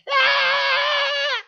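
high, loud, long wailing cry; long high mock wail; loud child's wail. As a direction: wail your heart out like a child.

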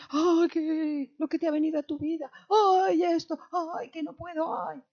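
high, fast, whining mockery of a worn-out believer; whiny complainer. Preacher mocking believers who see only ghosts in their trials, high and whiny. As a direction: whine like someone who has given up.

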